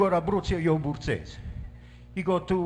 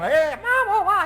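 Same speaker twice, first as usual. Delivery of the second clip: higher, louder panicked wail; mock screaming; 12 semitones higher, 5 dB louder, slower than usual. Bride running to her mother, mock screaming. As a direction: whine it high to mom. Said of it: "She comes screaming down".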